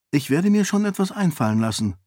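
zügig, bemüht selbstsicher; gespielt zuversichtlich. A narrator's reading. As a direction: Unsicherheit mit flotter Zuversicht überspielen. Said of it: "versuchte, zuversichtlicher zu klingen". (Sarene gibt sich vor den Lords zuversichtlicher, als sie ist)

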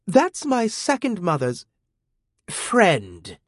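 put-on high, clenched furious girl; through gritted teeth. A narrator's reading. Furious teen girl to the young man, through gritted teeth. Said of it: "she said through gritted teeth".

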